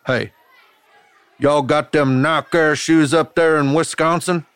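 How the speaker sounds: high, loud southern kid imitation; Tennessee accent. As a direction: mimic a southern bully calling out. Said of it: "the way his Tennessee accent came to me".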